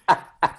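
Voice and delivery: choppy, stuttering evil chuckle; stuttered evil laugh. Retold or imitated. Imitating Tom's gleeful stuttered evil laugh. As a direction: laugh in little evil bursts. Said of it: "stuttered laugh of evil that he has".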